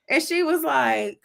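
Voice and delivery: ordinary delivery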